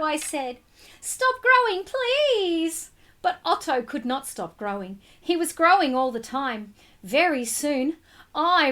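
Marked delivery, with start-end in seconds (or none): 1.0-2.9 put-on high, pleading child; high, begging boy. A narrator's reading. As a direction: beg the fish to stop growing. Boy begs fish to stop.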